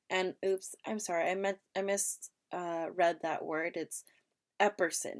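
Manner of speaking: normal talking voice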